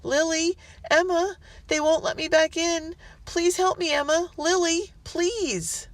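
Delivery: put-on high, pleading child; frightened little girl begging her friends; little girl's voice. A narrator's reading. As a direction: beg to be let in. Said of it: "a familiar little voice".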